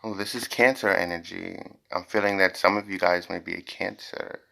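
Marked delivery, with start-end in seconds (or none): none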